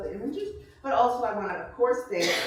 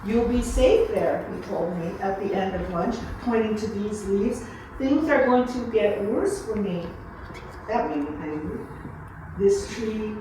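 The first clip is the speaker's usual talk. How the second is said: slow, louder, unhurried reading to a room of guests; story reading; 5 dB louder, slower than usual. Acted. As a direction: let each line land like a remembered story. Reads her story aloud to guests.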